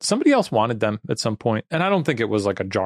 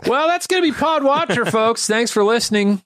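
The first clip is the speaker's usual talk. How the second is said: bright loud host outro; podcast sign-off; 12 semitones higher, 3 dB louder than usual. Acted. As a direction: wrap up the show cheerfully.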